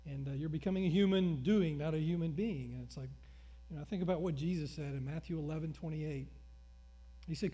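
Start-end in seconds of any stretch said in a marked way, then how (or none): none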